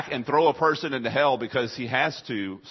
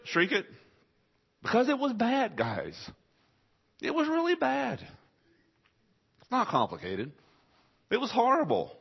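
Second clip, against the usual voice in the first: impassioned; 12 semitones higher than usual. Acted. Preacher stresses horror of cross.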